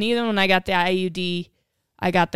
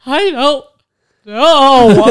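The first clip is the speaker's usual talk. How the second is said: high, loud, half-laughing wail; mock-sob the words out; tearful wail; 5 semitones higher, 11 dB louder, much slower than usual. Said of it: "I'm like".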